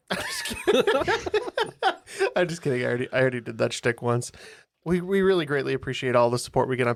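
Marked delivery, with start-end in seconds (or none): 0.1-2.8 laughing through the words; giggling out a joke retraction. Real, not acted.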